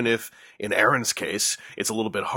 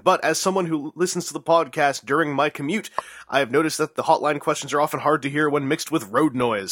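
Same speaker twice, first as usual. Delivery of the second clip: brisk, raised reading voice; animated message read-out; 4 semitones higher than usual. Acted. Host reading a listener's message to the audience, animated read-out. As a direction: read it out with showy energy, slowing grandly on the sign-off.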